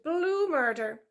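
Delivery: high, slow drawn-out squeal of blue murder; squealing piglet. A narrator's reading. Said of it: "the piglet had squealed".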